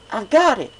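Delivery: high, loud burst of a bright idea; excited cry. A narrator's reading. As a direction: shout it as the idea hits. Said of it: "suddenly leapt up, her eyes alight".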